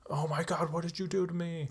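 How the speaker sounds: high, whiny mock freak-out; imitating someone panicking at being stabbed; mock panic. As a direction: ham up a panicked dying man. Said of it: "like, freaking out".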